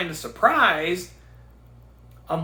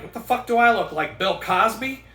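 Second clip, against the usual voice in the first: louder, higher mock-offended snap; indignant retort; 5 semitones higher, 6 dB louder than usual. Bakery worker joking back at a coworker, loud mock outrage. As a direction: fire it back, fake outraged. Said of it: "I'm like".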